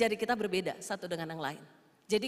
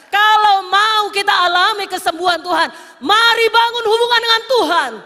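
loud, high sermon build; fiery preaching; 8 semitones higher, 14 dB louder than usual. Acted. Preacher rousing the congregation, full-force preaching.

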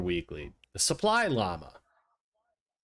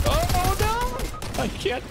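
being chased; 6 dB louder than usual. Real, not acted. They're just chasing me down.

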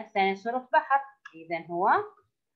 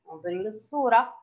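ordinary talk

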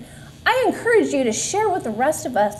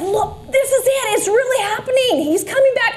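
higher, awed and eager, slowing on the first words; retold excited cry; 9 semitones higher than usual. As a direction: recall the moment you thought He was coming. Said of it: "and I said".

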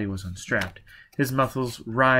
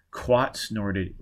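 plain reading voice

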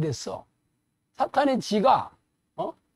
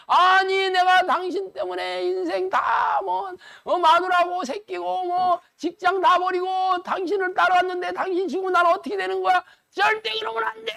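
high, pleading protest that climbs to a cry; hammed-up panicked yelling at his master; retold angry outburst; 8 semitones higher than usual. Pastor voicing Peter protesting to Jesus, mock shouting. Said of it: "막 버럭버럭 소리 지르잖아".

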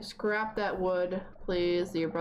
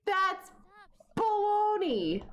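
put-on scoffing kid voice; 10 semitones higher, slower than usual. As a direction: call it baloney like a cranky kid. Voicing sim scoffing.

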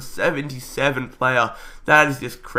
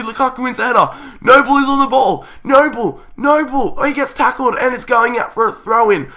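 fast, higher, louder urgent call; frantic commentary; 8 semitones higher, 4 dB louder than usual. Real, not acted. Commentator urging his side through the final attack. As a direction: build tension on the last attack.

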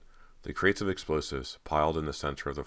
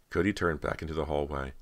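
ordinary delivery

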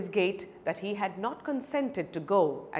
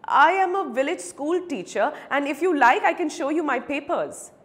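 higher, louder indignant insistence; insistent protest; 6 semitones higher, 5 dB louder than usual. Acted. Accused beggar defends his claim to the advocate, insistent. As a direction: protest your honesty, pitched up.

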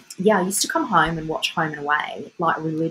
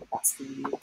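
soft whispered dedication; 6 semitones lower, 12 dB quieter than usual. Real, not acted. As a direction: breathe it out.

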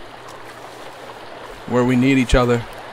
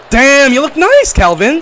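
delighted praise; 11 semitones higher, 6 dB louder, slower than usual. Real, not acted. Dressing friend in tracksuit.

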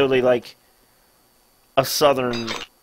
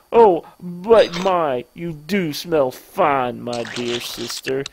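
slow, savouring compliment; creepy brother; 5 semitones lower, much slower than usual. Acted. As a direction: stretch it out, sinking low at the end. Creepy brother admires sister's smell.

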